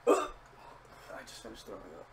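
low, slow, drained voice; weak after vomiting. Real, not acted. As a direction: speak drained after throwing up.

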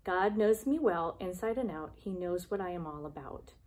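ordinary talk, nothing marked

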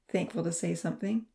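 ordinary delivery

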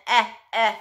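a clear short "eh", said twice; short E sound. Shown as a demonstration. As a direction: model the vowel "eh" like in hen. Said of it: "We're going to work on the short E sound".

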